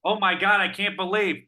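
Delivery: high, loud gushing; excited. Pretend. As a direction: gush like an overexcited fan. Mock excitement at the sign-off.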